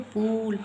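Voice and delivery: पूल held on a long oo. Shown as a demonstration. Teacher contrasting it with short u, long oo. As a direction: stretch the oo of पूल. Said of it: "हम पूल थोड़ी कह देते हैं".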